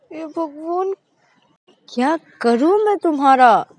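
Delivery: high, loud despairing sigh of a voice; fed up with her, cry it out; exasperated girlfriend. A narrator's reading. Shreya exasperated. (झुंझलाई हुई ऊँची आवाज़)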